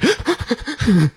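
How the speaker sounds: high burst of laughter. Real, not acted. Dad laughing high while telling his wife the story.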